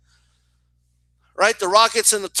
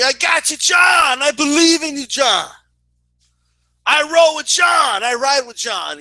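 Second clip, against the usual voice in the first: imitated superfan yell, easing toward the end; Carcino marking out; 6 semitones higher than usual. Fan pledging loyalty to John, marking out. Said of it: "I just saw Carcino mark out the other day".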